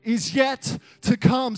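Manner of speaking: slow, loud preacher shout; shouting the promise to the congregation; preaching at full force. Acted. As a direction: preach it to the rafters.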